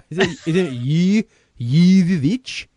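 high, loud, slow cartoon Swedish accent; Woody Woodpecker Swedish walrus voice. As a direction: drag out the name like a goofy Swedish cartoon. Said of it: "in the Woody Woodpecker Swedish Walrus voice".

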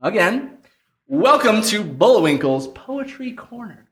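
ordinary talk, nothing marked

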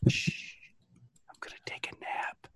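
unvoiced creepy whisper. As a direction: breathe it out softly. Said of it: "That's what he whispered".

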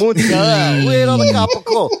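mock New York Italian; New York accent. Piling onto the deli order, mock New York accent. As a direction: lean into the Italian food names like a New Yorker. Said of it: "like, a New York accent".

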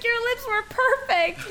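sweet coworker. Retold or imitated. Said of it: "She's like, she's really nice".

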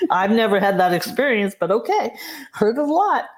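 bright amused laugh rising to a high finish. Real, not acted. Laughs at own past skepticism.

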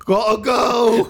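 mock boxer shout. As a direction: bellow it slowly on the way out. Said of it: "Mike Tyson?".

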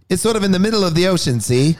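fast, high Mid-Atlantic accent. Shown as a demonstration. Demonstrating the Mid-Atlantic accent he wants to learn. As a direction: rattle it off in a clipped Mid-Atlantic voice. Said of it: "a Mid-Atlantic one, which is more clipped".

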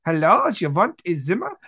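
hammed-up German accent, swooping pitch; lilting mock-German greeting; German landlord. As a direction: play a comic German innkeeper. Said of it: "the little German landlord guy".

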